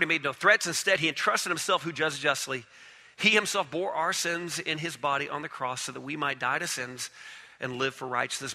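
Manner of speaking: usual speaking voice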